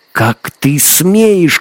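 put-on high, loud, drawn-out girl's cry; indignant shout. A narrator's reading. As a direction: cry out in outrage, stretching the words. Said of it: "крикнула белка".

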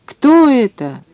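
high-pitched alarmed question; startled yell. A narrator's reading. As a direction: demand it in a startled cry. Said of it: "завопил он". (испуганный вопль)

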